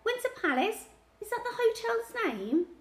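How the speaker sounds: put-on very high child's query; urgent questioning. A narrator's reading. Child checking the hotel with grandad, urgently questioning. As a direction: check it eagerly, pitch shooting up.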